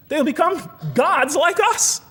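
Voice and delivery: slow, high mock-divine voice; a slow, high hint of a jealous deity; jealous God. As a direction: play God guarding his throne, slowly and high.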